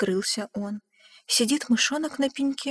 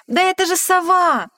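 loud, high shout; 8 semitones higher, 6 dB louder than usual. Acted. Mouse shouting out on spotting the owl. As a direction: call out across the forest. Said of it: "мышонок закричал".

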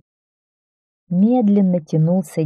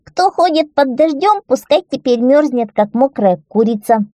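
put-on high, raised youngest-child voice; loud cry; 6 semitones higher, 4 dB louder than usual. A narrator's reading. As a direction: call it out loudly, taunting like a little kid. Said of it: "громко крикнул". (громкий детский выкрик)

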